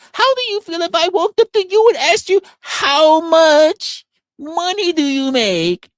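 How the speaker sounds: high, loud put-on woman's voice, dragging out the question; imitatie van vrouw. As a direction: mimic her way up high, slowing heavily at the end. Mimicking woman asking about income.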